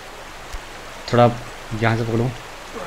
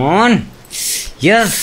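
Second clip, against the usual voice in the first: excited yes; 8 semitones higher, much slower than usual. Real, not acted. Success after many falls.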